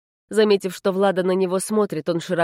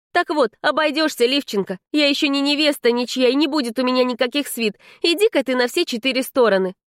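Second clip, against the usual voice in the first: higher-pitched, indignant brush-off; exasperated girl laying down the law; defiant heroine; 8 semitones higher than usual. Acted. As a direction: tell a pest to get lost. Heroine sends the house spirit away.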